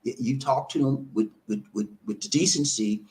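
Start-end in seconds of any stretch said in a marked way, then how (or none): none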